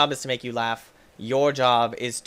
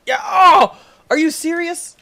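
high squealing outburst, much higher and louder; outraged disbelief; 11 dB louder, slower than usual. Real, not acted. Gamer shrieking at a stuck ball in disbelief.

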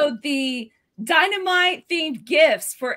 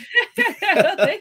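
burst of laughter; 6 semitones higher, 6 dB louder than usual. Real, not acted. Co-host praised, laughs in reaction.

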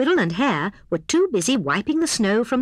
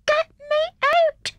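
very high, slow little plea; tiny squeak; slower than usual. A narrator's reading. Hedgehog trapped in snowball begs rescuers, tiny squeak. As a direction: squeak each word out, drawn long. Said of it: "squeaked a tiny muffled voice".

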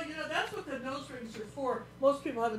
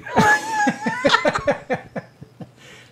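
high, loud burst of laughter; 12 dB louder than usual. Real, not acted. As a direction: crack up loudly. Big laugh at mic trick.

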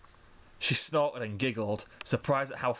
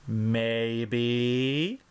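coo. A narrator's reading. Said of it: "Scootaloo cooed".

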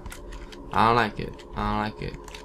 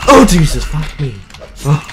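sudden loud startled cry; jolted by a jump scare; startled yelp; 4 semitones higher, 13 dB louder than usual. Real, not acted. Gamer jolted by a monster, yelping in real fright.

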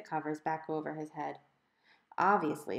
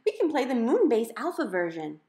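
higher and louder, still thrilled; eager kid; 5 semitones higher, 6 dB louder than usual. A narrator's reading. Violet pitching her game to Mom, eager and bright. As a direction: keep the excitement going.

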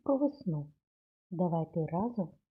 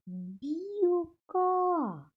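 1 slow sounding out; 10 semitones higher than usual. Shown as a demonstration. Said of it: "І ставимо дві рисочки".

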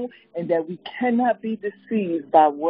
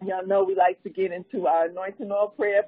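ordinary talk, nothing marked

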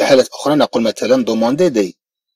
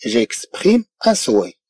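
very slow, softer French; slow model reading; 2 semitones lower, 4 dB quieter, much slower than usual. Shown as a demonstration. French teacher reading to students, slowly. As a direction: spell it out slowly for learners. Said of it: "أقول مثلًا".

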